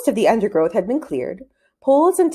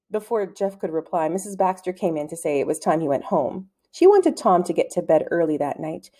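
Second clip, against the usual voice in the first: plain reading voice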